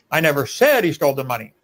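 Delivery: said punched with a pitch jump; emphatic SAID in mid-sentence; stress on SAID. Shown as a demonstration. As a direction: lean hard on the word said. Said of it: "emphasizing different words in that sentence".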